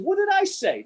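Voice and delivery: high, pointed mock question; a coach teasing his campers, high and mock-stern; mock indignant. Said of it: "I said".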